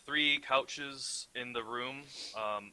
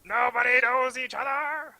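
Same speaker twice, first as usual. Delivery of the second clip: excited exclaim; 12 semitones higher, 11 dB louder than usual. Real, not acted. GM announces the low roll result.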